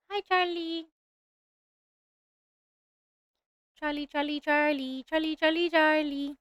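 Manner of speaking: softly crooning a name; cooing sing-song. Real, not acted. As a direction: coo the name like a pet. Cooing at drawn character.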